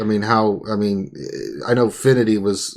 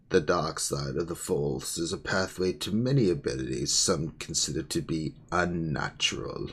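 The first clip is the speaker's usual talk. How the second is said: dark side voice; 6 semitones lower, 11 dB quieter than usual. Shown as a demonstration. Demoing the quote in dark voice.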